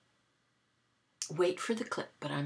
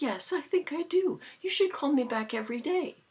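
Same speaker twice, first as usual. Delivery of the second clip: high, louder teasing sweet-talk; playful flirting; 8 semitones higher, 5 dB louder than usual. Retold or imitated. Woman inviting scammer to call back, playfully flirting. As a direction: flirt cheerfully, pitched well up.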